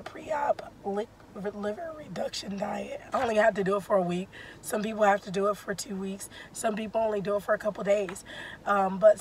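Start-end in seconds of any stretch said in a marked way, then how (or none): 0.0-3.0 whispering. Real, not acted. Voice failing mid sentence.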